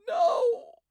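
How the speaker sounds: high, loud cry of grief; anguished cry. Acted. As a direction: wail it out. Daughter over dead father.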